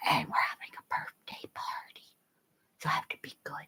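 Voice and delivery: hushed whisper; soft unvoiced whisper. Real, not acted. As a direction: whisper so the dog stays calm. Whispering near sleeping dog.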